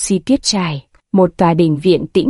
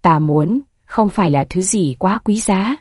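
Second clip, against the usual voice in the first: plain reading voice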